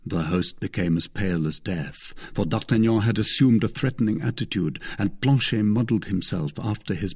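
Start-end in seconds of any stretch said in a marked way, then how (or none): none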